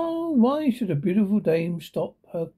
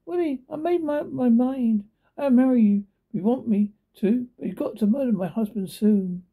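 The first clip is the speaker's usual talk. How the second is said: higher voice for the scheming lady; lady voiced noticeably higher; rich lady; 6 semitones higher than usual. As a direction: pitch up as the cool rich lady making an offer.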